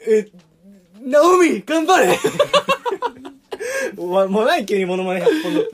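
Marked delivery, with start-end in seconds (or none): 1.0-2.2 loud, higher cheering shout; Shuzo Matsuoka impression. Impression on request, loud and higher cheering. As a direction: yell it like a fired-up tennis coach. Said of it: "松岡修造".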